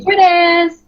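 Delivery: high excited squeal of a word; playful cry. Real, not acted. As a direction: blurt it out for fun. Playful exclamation.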